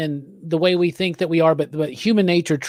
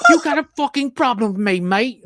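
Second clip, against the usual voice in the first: raised, clipped British merc voice with a laugh breaking through; uptight British mercenary; 5 semitones higher than usual. Retold or imitated. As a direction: sneer like a stuck-up British soldier of fortune. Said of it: "the British guy who has just gotta stick up his ass".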